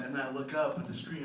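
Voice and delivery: usual speaking voice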